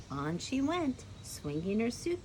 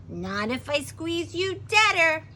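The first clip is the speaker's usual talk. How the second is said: high, loud counter-threat; defiant comeback; 7 semitones higher, 8 dB louder than usual. A narrator's reading. Girl answers the threatening snake back, loud and defiant. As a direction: throw the threat right back, loudly.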